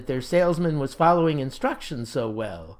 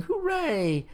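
crying hooray; 12 semitones higher, 3 dB quieter than usual. A narrator's reading. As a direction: cheer like delighted young scouts.

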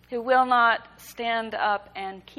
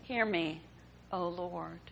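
fading, lower repeat of the plea; softer echoed plea; 3 semitones lower, 8 dB quieter than usual. A narrator's reading. Hagar repeating her cry to the Lord, fading and quieter. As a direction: let it sink lower and quieter. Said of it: "all others cried out".

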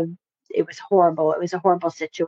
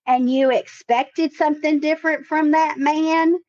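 higher, lightly put-on Texas accent of an older therapist; 6 semitones higher than usual. Therapist leaning back in her chair, challenging the client, in a Texas accent. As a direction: imitate the therapist's Texas accent with a pointed question. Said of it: "She has a Texas accent".

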